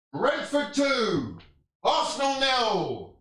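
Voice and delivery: high, slow scoreline call; mock score announcement.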